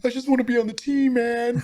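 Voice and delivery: whiny wannabe player. Pretend. Mocking eager benchwarmer.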